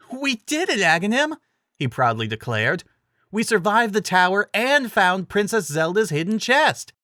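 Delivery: high, bright triumphant cheer; cheer of excitement. A narrator's reading. Link celebrating finding the chest, proud excited cheer. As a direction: cheer and proudly declare the win. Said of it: "a cheer of excitement".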